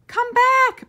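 high, loud call after a runaway goat; a child's yell to a fleeing goat; hollered. A narrator's reading. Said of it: "Peter hollered Yankel".

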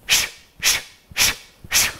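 short detached sh bursts; staccato shushing. Shown as a demonstration. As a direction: clip each sh short. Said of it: "Let's try it together".

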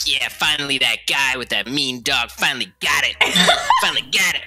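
laughing parody of gossiping neighbors; mock gloating neighbors. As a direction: gloat like the neighbors finally got justice. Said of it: "walking around like".

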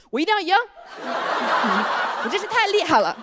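lightly hammed-up, high-pitched self-cheering. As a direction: play an over-positive, eager-to-win contestant. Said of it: "没有一个超模在拍照的时候内心是呼兰". (略带夸张的积极自信)

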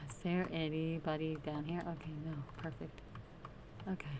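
soft, nervous calling out; tiptoeing, keeping voice down; hushed. Real, not acted. Sneaking through dark tunnel.

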